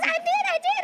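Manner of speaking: very high giddy squeak; dolphin squeal. Retold or imitated. Niece confirming she got the role, high dolphin squeal. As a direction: chirp it out high and fast like a dolphin. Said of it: "two dolphins talking".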